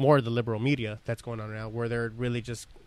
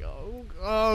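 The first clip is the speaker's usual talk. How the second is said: mock exasperation; 8 semitones higher than usual. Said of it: "I'm like".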